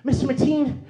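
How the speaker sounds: put-on high, loud, whining child; whiny tattletale kid. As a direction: nag the teacher like a persistent little tattletale.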